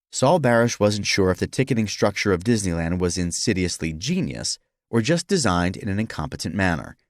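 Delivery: usual speaking voice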